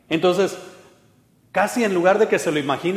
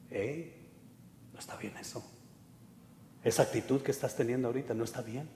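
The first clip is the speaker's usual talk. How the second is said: soft unvoiced whisper of the Spirit; 3 semitones lower, 15 dB quieter than usual. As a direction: whisper it gently, like a conscience. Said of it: "el Espíritu nos susurra". (susurro suave del Espíritu)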